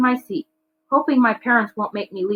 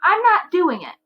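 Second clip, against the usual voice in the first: defiant refusal; 11 semitones higher, 3 dB louder than usual. A narrator's reading. Teen refusing parents.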